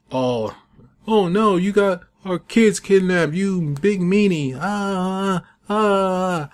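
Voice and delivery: high, whiny cry of a distraught wife; mock panicked wife. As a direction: ham up the sobbing wife, pitched high. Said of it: "The wife goes to the husband".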